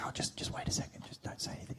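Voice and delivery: urgent whisper, fast at first; whispering quickly behind the phone; hushed whisper. Retold or imitated. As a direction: hush him so the caller won't hear. Girlfriend hushing him during a call.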